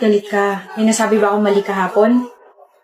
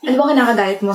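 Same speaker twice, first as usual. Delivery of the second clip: usual speaking voice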